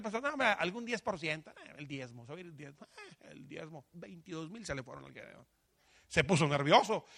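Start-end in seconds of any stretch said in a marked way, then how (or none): 0.2-3.9 mock inner thought. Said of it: "de seguro Gedeón pensó".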